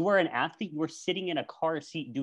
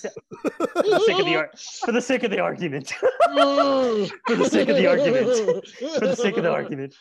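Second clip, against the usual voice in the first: laughing mock repeat; 10 semitones higher, 8 dB louder than usual. Mocking repeated catchphrase, laughing.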